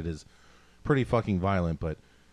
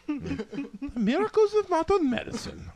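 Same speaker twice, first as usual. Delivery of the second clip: much higher, hammed-up German accent; Mercy German accent. Mimicking Mercy's line for the podcast friends, mock German accent. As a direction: toss off a healer's line in a put-on German accent. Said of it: "No, it's supposed to be German".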